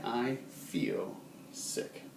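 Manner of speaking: drawn-out unvoiced whisper, very slow; sick and weak. Shown as a demonstration. Teacher showing students how sick sounds, faint whisper. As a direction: let the words sag as if ill. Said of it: "more generically".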